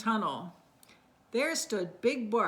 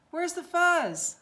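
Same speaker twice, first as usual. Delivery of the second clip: alarmed question; 9 semitones higher, 5 dB louder than usual. Acted. Dogs waking, fuzz gone.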